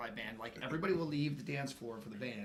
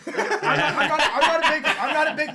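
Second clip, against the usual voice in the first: laughing; 12 semitones higher, 14 dB louder than usual. Real, not acted. Laughing confessing about rides.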